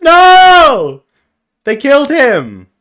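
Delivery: loud, high, drawn-out cry; mock anguished wail. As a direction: wail in hammed-up grief. Mock outcry at a character's death.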